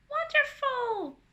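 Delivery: high, loud, stretched exclamation; shout. A narrator's reading. Wonderful on hearing Anna laughing, shouting. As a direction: shout it out with delight. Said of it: "shouts Wonderful".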